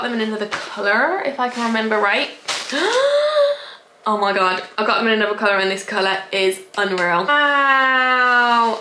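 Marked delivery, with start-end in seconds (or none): 2.3-3.7 sharp, high gasp of delight; excited gasp. Real, not acted. Vlogger sees the new colour, excited gasp. 7.3-8.8 long drawn-out, breath-filled wow; awed wow. Real, not acted. Vlogger admiring new cargos, awed wow.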